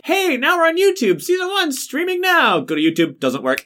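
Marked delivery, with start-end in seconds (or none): 0.0-2.6 high bright promo read; cheery promo voice. Retold or imitated. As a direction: announce it like an upbeat ad. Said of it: "we're just like".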